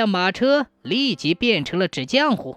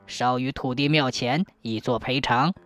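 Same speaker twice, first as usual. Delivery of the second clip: put-on old earth god; 6 semitones lower than usual. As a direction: hand down a verdict like an old village god. Earth god passes judgment.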